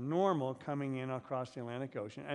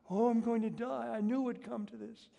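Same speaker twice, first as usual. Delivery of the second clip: higher-pitched hammed-up terror; mock panic; 9 semitones higher, 3 dB louder than usual. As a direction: act out your own panic on the plane, mildly. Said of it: "and I'm screaming".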